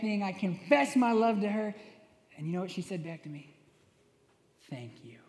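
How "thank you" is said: very soft, low, with a laugh under it; imitating the girlfriend's flat answer; flat deadpan reply. Girlfriend's reply to love letter.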